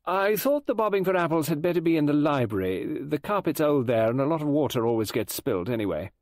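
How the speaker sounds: high, busy hostess voice; brisk party organiser. Acted. Voices Rowena Drake organising party.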